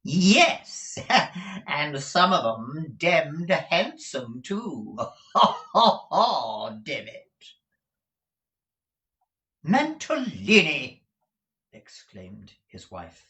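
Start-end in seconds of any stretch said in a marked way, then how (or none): 0.1-7.4 high, loud drawl breaking into ha-ha; laughing, leering Mr Mantalini. Acted. Husband boasts of the handsome workers, laughing high drawl. 9.6-11.0 high, loud, slow rebuke; scolding wife drawing out the name; awful voice. A narrator's reading. Wife exclaims at her husband, awful scolding voice. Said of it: "in an awful voice".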